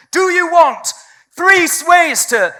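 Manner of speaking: high, loud pushy sales pitch; hammed-up marketing shout; mock salesy hook voice. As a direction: shout it like a clickbait ad.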